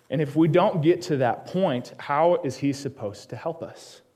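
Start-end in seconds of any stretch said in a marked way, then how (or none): none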